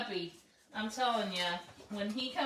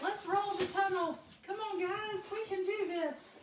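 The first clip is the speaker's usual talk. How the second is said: much higher playful puppy voice; voicing the puppies; 10 semitones higher than usual. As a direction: speak for the pups, high and eager. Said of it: "They're like".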